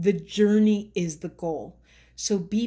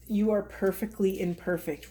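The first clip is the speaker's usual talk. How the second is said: normal talking voice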